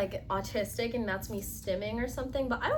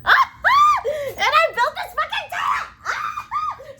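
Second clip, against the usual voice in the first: much higher and louder, slow, laughing shout; excited shout; 12 dB louder, much slower than usual. Real, not acted. Vlogger shows off her self-built tent, an elated laughing shout. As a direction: yell it out with delight.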